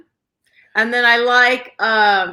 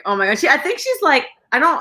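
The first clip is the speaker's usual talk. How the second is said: ordinary delivery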